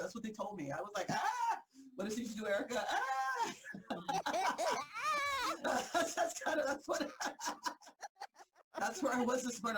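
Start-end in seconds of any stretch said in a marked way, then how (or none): 1.1-1.6 high squealing mock shriek; playful fake scream; mock startled scream. 2.9-5.6 high shriek melting into laughter; high squealing laugh. Retold or imitated. Screaming then laughing.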